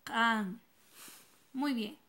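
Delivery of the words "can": can said loud and clear in Mam, a single crisp syllable; Mam word, clearly modelled. Shown as a demonstration. Teacher models the Mam word for yellow, loud and clear. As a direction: model can slowly for the class to repeat. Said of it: "Otra vez".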